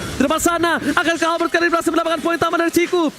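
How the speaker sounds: high, hyped live play-by-play; fighting game announcer. Real, not acted. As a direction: call the fight like an arena announcer.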